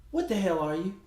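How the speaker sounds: usual speaking voice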